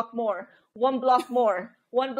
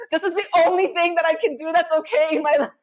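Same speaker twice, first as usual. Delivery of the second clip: laughing; 4 semitones higher than usual. Retold or imitated. Quote said through a laugh.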